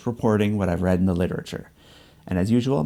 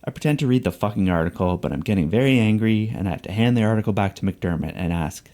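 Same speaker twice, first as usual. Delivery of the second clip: normal talking voice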